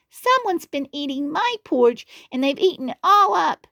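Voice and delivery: high squeaky little bear; little cub upset about porridge; Baby Bear crying. Acted. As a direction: pitch it way up, whiny and small. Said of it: "he cried".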